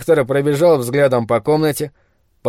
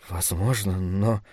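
very soft, low murmur; whispered reply; 6 semitones lower, 9 dB quieter, slower than usual. A narrator's reading. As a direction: answer under your breath, much lower. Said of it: "прошептал в ответ Брэдли". (тихо, почти шёпотом)

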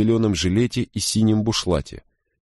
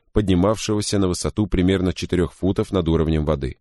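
plain reading voice

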